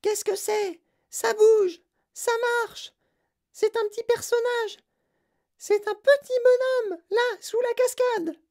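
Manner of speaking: very high, frantic squealing voice; shrieking like a madwoman. A narrator's reading. Veruca spots a little man under waterfall, shrill shrieking. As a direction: scream out each startled phrase. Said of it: "en hurlant comme une folle".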